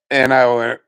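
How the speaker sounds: normal talking voice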